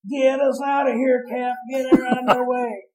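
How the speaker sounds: high, loud imitation of a panicked shout; panicked hollering. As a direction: holler it like a company man in total panic. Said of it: "And he's hollering".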